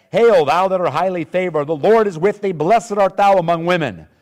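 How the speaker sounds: proclaimed greeting. A narrator's reading. Angel greets Mary as highly favored, proclaimed loudly. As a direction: proclaim it like a herald.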